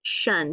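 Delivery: "Shun" low and short, like the SH in shut; teacher giving the TION sound to the class. Shown as a demonstration. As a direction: say the ending "Shun" clearly. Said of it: "the picture we will use".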